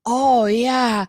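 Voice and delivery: drawn-out swooping oohs; Jennifer Coolidge. Retold or imitated. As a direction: lightly mimic a spacey diva, vowels stretched. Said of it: "a little bit of Jennifer Coolidge me".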